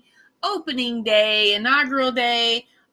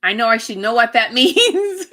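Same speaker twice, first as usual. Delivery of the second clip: loud, breaking into laughter; real laugh bursting through the words; embarrassed laughing; 6 dB louder than usual. Real, not acted. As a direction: laugh at your own blank mind. Laughs at drawing a blank.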